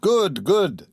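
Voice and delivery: high, ringing cheers; the people cheering the play; crowd cheer. A narrator's reading. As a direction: cheer as the whole hall. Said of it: "shouted the people".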